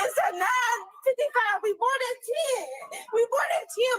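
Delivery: wailed, distraught insistence; real sobbing plea; tearful pleading. Real, not acted. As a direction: cry out the words in despair. Crying over flight time.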